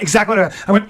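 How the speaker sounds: ordinary talk, nothing marked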